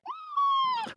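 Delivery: high, hammed-up gasp; shocked gasp. Viewer watching her turn on a dime, shocked gasp. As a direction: gasp sharply in mock astonishment.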